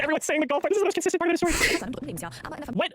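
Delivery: high-pitched, giggly disbelief; amused, voice way up high; laughing. Real, not acted. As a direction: squeak it out while cracking up. Still laughing at the comments.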